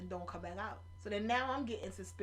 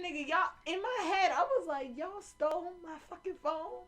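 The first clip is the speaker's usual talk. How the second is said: high, loud incredulous outburst; outraged disbelief; 9 semitones higher, 9 dB louder than usual. Retold or imitated. Reacting to the thieves asking her for a ride, outraged. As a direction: explode at the nerve of it.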